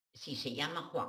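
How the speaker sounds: usual speaking voice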